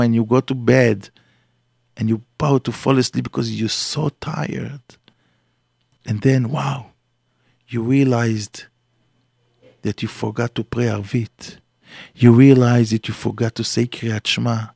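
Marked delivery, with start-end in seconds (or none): none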